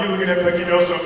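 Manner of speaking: usual speaking voice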